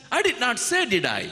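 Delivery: God reminds Adam he never said it; God's gentle challenge. Acted. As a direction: tease out the truth. God's rhetorical question, high.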